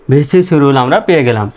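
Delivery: usual speaking voice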